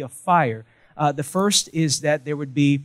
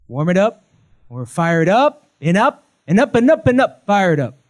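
rallying cry; 5 semitones higher, 9 dB louder than usual. Acted. Preacher rallying the church.

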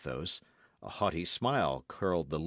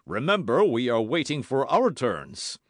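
louder, impatient call; impatient cry; 5 semitones higher, 8 dB louder than usual. A narrator's reading. Porthos calls out impatiently to the duellists. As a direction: remind them loudly of their turn. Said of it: "cried Porthos".

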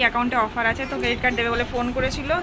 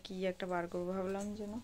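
speaking softly; 2 semitones lower, 11 dB quieter than usual. Real, not acted. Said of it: "ওই জন্য একটু আস্তে আস্তে কথা বলছি". (ঘুমন্ত বাচ্চার পাশে খুব আস্তে কথা বলা)

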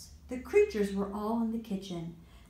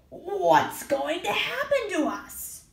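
worried monster; 10 semitones higher, 4 dB louder than usual. Acted. Rug monster frightened.

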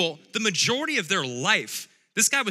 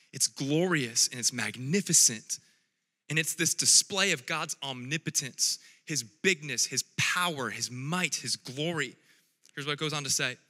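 ordinary delivery

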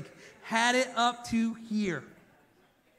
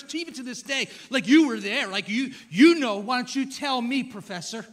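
sarcastic God; 4 semitones higher, 8 dB louder than usual. Retold or imitated. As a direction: needle him like a smug know-it-all. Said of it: "He gets really sarcastic".

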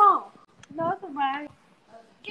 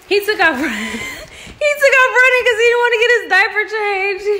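laughing; 4 semitones higher, 5 dB louder than usual. Real, not acted. Laughing retelling.

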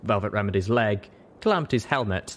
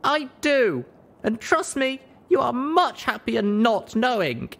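grim; 3 dB louder, slower than usual. Acted. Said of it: "Grimly I answered".

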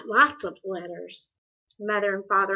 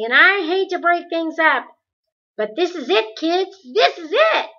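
much higher and louder, eager; quivering with excitement; 9 semitones higher, 10 dB louder than usual. Acted. Charles Wallace tells Meg and Calvin it's time, excitedly. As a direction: burst with the news. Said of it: "His voice quivered with excitement".

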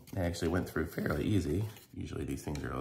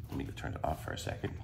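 ordinary talk

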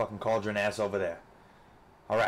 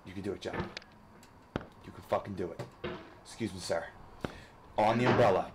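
usual speaking voice

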